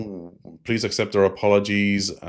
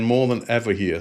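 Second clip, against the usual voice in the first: ordinary talk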